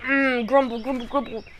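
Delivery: reluctant man muttering his complaint; grumbling. Retold or imitated. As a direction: grumble comically under your breath. Man acts out grumbling before giving in.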